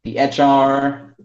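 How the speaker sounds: normal talking voice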